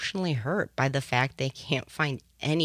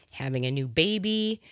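ordinary delivery

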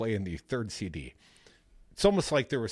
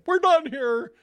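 higher, louder mock exclamation; retold indignant outburst; 12 semitones higher, 6 dB louder than usual. Host imitating an offended man at the bar, mock outburst. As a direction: imitate him loudly calling it off, pitched way up.

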